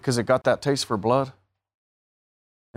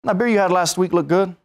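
high, louder mock of the guy giving in; higher sheepish voice talking himself into a beer; mock hesitant guy; 8 semitones higher, 4 dB louder than usual. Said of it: "You're like".

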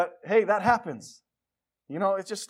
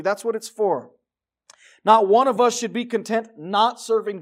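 full-voiced sermon preaching; 7 dB louder than usual. Real, not acted. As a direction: preach it to the congregation. Preaching in church.

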